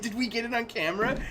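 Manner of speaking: high-pitched excited child impression; imitating his kid. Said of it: "he's like".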